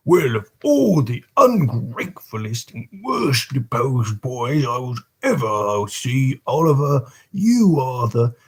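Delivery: a hint of a scolding beadle, slowed; lightly voiced Bumble. Beadle berates the orphan in a mild scolding voice. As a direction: grumble the reproach, barely in character.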